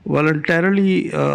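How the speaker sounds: normal talking voice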